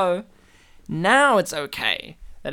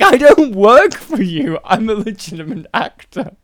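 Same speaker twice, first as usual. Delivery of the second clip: indignant actor; 4 semitones higher, 8 dB louder than usual. Retold or imitated. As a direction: burst out in hammed-up protest. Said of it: "And he's like".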